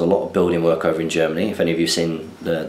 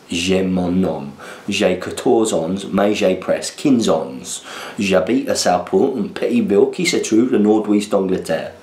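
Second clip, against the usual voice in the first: put-on slow French with a southern English accent; schoolboy reading French in his southern English accent; slower than usual. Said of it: "if you don't do it in a Cockney accent or a Southern accent".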